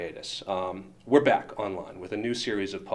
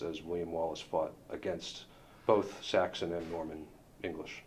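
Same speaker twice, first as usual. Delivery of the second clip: formal letter reading. Acted. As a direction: read it out like a stiff legal notice. Reading a lawyers' letter aloud.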